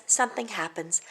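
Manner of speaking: plain reading voice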